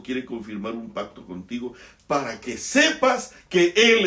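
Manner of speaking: loud, high preaching at full force; fiery preaching. Acted. Preacher to the congregation about knowing the Father, fiery. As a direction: thunder the majesty of the Father.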